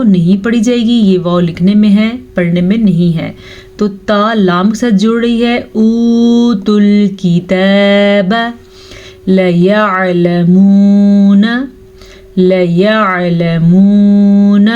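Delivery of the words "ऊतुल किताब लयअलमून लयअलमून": slow recitation with short लाम in लयअलमून. Shown as a demonstration. Teacher reciting verse slowly. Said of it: "लाम को लंबा करने की गलती मत कीजिएगा".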